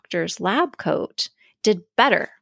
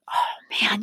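whisper; 4 dB quieter than usual. Retold or imitated. Imagined excited self-talk, whispered.

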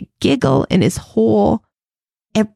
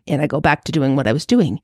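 normal talking voice

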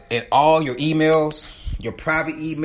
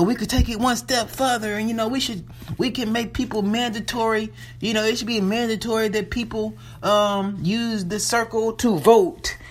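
high-pitched, imitated company pitch; put-on high spokesperson voice; imitating a spokesperson; 8 semitones higher than usual. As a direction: voice a spokesperson's proposal in a higher register.

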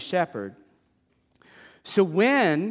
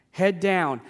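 ordinary talk, nothing marked

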